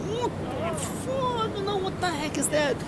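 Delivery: high, soft mock outcry; imitated disgusted passengers. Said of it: "All the passengers around were like".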